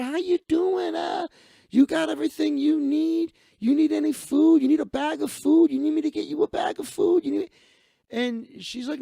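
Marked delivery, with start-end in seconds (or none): 0.0-7.5 high, loud, gushing imitation; eager offering. Man offers the woman a bag of food, eager and excited. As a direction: pile one eager question on the next.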